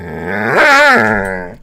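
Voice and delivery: slow put-on dog voice; dog's voice. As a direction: speak for the dog, dragged out. Voicing the dog slowly.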